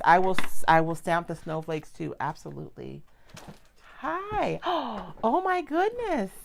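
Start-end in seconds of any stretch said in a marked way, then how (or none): 4.0-6.3 high, delighted gasp at the grandbaby. Real, not acted. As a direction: coo with surprise and joy. Grandbaby arrives, baby talk.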